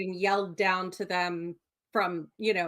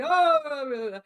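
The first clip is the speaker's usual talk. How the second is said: long, high, loud "oh"; hammed-up cliffside call, drawn out; mock dramatic cry; 7 semitones higher, 5 dB louder than usual. Picturing a speech from the cliffside, mock dramatic wail. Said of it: "You have this dramatic standing on a cliffside".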